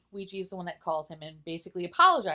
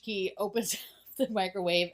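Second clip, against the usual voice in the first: ordinary talk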